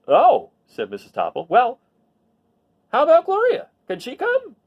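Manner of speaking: lively storybook read-aloud; reading to children. A narrator's reading. Teacher reads a picture book to the class, lively storybook voice. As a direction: tell the story to a class of kids.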